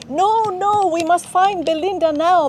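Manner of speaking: put-on high-pitched, insistent little girl; protesting child. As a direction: plead and refuse in a child's voice. Crying child refuses to leave.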